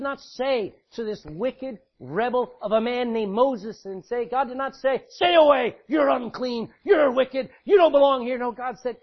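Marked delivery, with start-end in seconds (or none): 5.1-8.4 loud, high shouted rejection; rejecting shout. Acted. God shouting Moses away as unclean. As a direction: drive him out with a shout.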